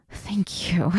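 breathless whispered thank you; barely voiced, grateful whisper. Grateful hushed thanks in roleplay.

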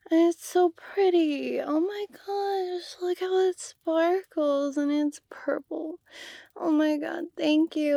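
high-pitched, gushing delight. Acted. Admiring the purple necklace from her friend, gushing high. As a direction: coo over it like a treasure.

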